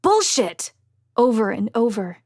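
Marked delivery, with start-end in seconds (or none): 0.0-0.7 high, loud angry shout; explosive, raised-pitch yell. A narrator's reading. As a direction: shout it out in outrage. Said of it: "Zev shouting the word".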